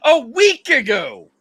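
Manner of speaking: high, loud gloating retort; triumphant boast. Retold or imitated. Husband gloating to his wife about calling Pennsylvania. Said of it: "And I said".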